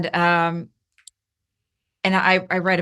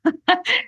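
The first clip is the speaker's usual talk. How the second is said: burst of laughter; 13 semitones higher than usual. Real, not acted. Relieved realization.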